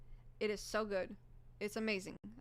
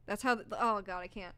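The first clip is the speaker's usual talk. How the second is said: ordinary talk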